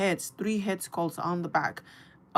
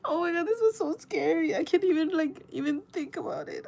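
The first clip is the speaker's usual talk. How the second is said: high, cracking scared voice; real fright; 9 semitones higher than usual. Real, not acted. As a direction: shaken and crying out at the memory. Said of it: "I'm getting scared".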